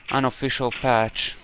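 normal talking voice

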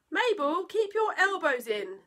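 high, loud telling-off; parent's exasperated reprimand; scolding parent. Acted. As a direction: scold a naughty child at the table.

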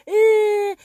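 high, loud fake crying; imitating fake grief at a funeral, high and loud sob; mock wailing. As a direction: wail like a fake mourner. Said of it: "motherfuckers wanna be like".

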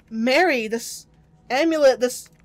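usual speaking voice

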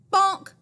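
loud, high comic bonk; bonk sound effect. A narrator's reading. Reading to kids, a loud playful bonk. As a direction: make the bonk pop out.

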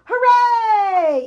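loud, high cheering shout; the fair crowd yelling with joy. A narrator's reading. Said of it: "yelled the crowd".

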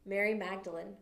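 ordinary talk, nothing marked

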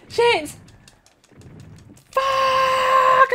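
high, very loud panic yell; real startled swearing; panicked shout. Real, not acted. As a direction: scream the swear words, stretching the last. Ambushed in game, nearly killed.

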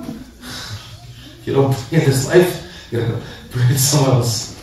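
slow, eerie whisper; haunt them in their sleep, very slowly; nightmare whisper. Pretend. Preacher haunts the sleepers who skip prayer, eerie whisper. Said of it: "I wanna come like a nightmare".

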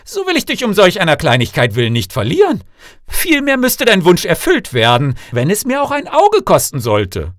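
loud, high, emphatic king; reassuring king. A narrator's reading. King promises to grant his daughter's wish, loud emphatic reassurance. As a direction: vow it loudly and briskly.

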